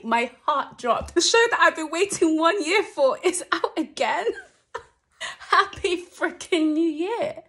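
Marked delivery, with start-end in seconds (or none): 1.1-5.2 near-screaming with joy, rising; overexcited. Real, not acted. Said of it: "I'm just screaming at the top". 5.5-7.4 laughing. Real, not acted. Laughing exclamation.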